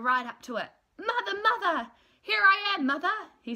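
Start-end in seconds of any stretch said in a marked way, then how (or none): 1.0-3.3 much higher and louder, eager cries; excited calling. Acted. Baby bird runs to the big thing, high eager calling. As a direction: run up crying out in joy.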